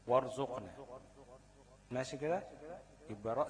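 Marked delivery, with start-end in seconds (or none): 0.1-0.7 وارزقنا said louder, with a thick, full r; the teacher shows the class a heavy r in وارزقنا; heavy r demo. Shown as a demonstration. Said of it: "الراء هنا ساكنة بعد فتحة الواو".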